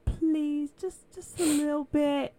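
little niece pleading for a bit of coffee, lightly voiced; begging little girl. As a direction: beg sweetly like a small kid wanting coffee.

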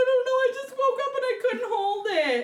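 put-on a touch of weepy toddler excuse; mild child whimper. Little girl explaining her accident to mom, teary. Daughter explains, close to tears.

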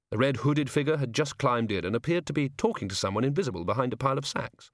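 ordinary delivery

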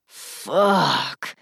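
low, drawn-out curse; dragged out. A narrator's reading. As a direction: stretch the single word out slowly. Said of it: "dragging the word out".

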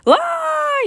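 very high, loud scream of fright; acted terrified yell. A narrator's reading. Teddy flees the floating bone, acted terrified yell. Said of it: "yelled Teddy".

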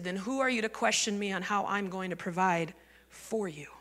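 low, very soft fatherly rebuke; fatherly Lord. Retold or imitated. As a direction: quietly put the doubter in their place. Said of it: "He speaks to me like my dad".

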